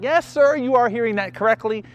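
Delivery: high, bright and eager; eager yes. Retold or imitated. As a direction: sell the yes with big enthusiasm. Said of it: "I'm like".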